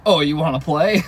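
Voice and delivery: the dog Pilot eager to chase the cleaner, playful voicing. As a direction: ask it like a dog wanting a game. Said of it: "and Pilot's like".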